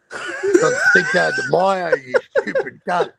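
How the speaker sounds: high, loud, laughing jeer at the commenter; laughing insult. Retold or imitated. Mocking a dumb online commenter, laughing loud and high. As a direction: crack up mid-insult, pitch shooting up.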